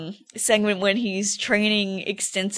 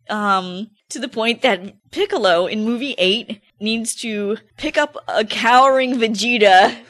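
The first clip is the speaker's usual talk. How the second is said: usual speaking voice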